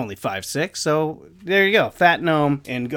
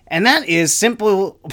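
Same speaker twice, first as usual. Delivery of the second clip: higher, louder opening push; emphatic start; 6 semitones higher, 5 dB louder than usual. Real, not acted. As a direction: launch the rant with force. Restarts the line after laughing.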